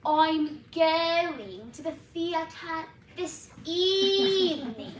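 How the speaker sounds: drawn-out nasal London toff; London British dialect. Shown as a demonstration. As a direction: say it in snooty, slow British. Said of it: "in the London slash British dialect".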